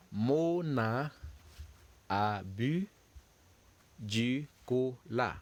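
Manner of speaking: "Mona a bu du cola" read slowly, "du cola" stretched; slow French sentence. Shown as a demonstration. Teacher repeats the sentence slowly for students.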